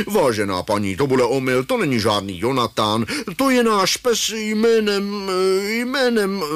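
put-on old gentleman, stalling high on the name; formal old man losing his thread; older gentleman's deep voice. A narrator's reading. Mach answers his mother, put-on older gentleman stuck for a name. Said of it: "hlubokým hlasem staršího pána".

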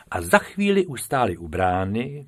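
ordinary delivery

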